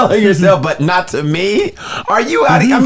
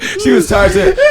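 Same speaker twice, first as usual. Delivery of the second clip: high laughing shout of "tired". Real, not acted. Comedian cracking up at the tired woman in the window.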